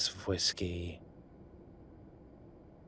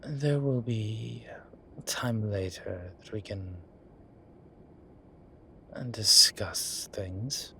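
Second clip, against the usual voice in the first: slow, secretive whisper; a hushed promise for later; promising whisper; slower than usual. Acted. Promising his assistant later talks, slow whisper.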